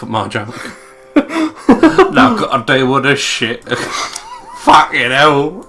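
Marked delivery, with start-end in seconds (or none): 1.1-2.1 squealing laugh, high and loud; high burst of laughter. Real, not acted. Friend ignores her pun, she bursts out laughing. Laughing at own failed joke. 2.1-5.7 words broken by laughter; laughing while talking. Real, not acted. Cracking up after a dead joke, laughing speech.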